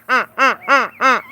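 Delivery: ordinary talk, nothing marked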